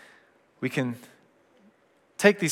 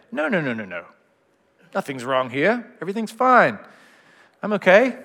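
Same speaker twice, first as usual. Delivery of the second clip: brushing it off, rising to a higher, louder insistence; a forced "everything's fine", getting higher; pretend denial; 5 semitones higher, 3 dB louder than usual. Acting out denial of feelings.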